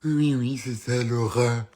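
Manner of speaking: slow, drawn-out mimicry of the name; mocking echo. Mother mimicking the son's new girlfriend, mocking. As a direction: echo it mockingly, dragging out the words.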